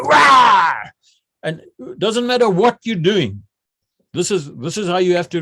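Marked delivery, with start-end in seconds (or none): 0.0-0.7 high, loud roar in answer; acted attack yell, let it rip; aggressive battle cry. Trainee answering the instructor's order, aggressive roar.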